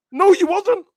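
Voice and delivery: high squeaky mock retort. Shooting down a panel boast, high squeaky mock denial.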